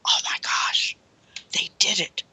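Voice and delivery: whispered, aghast; horrified whisper on realizing what they did; shocked whisper. Retold or imitated. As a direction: gasp it out under your breath. Harvey's horrified reaction.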